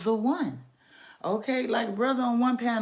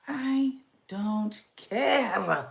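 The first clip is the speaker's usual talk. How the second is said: ordinary talk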